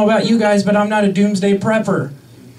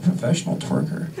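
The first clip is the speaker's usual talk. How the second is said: put-on small girl whisper; 6 dB quieter than usual. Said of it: "And I said".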